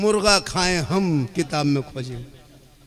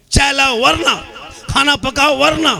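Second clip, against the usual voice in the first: mimicking bossy husband; 7 semitones higher, 5 dB louder than usual. Mocking bossy husband's threats.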